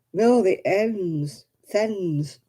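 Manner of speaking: slow "the hens" then "thens" blended, the h dropped; Lancashire thens. Shown as a demonstration. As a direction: run "the hens" together into "thens" in a Lancashire accent. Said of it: "Everything has a th".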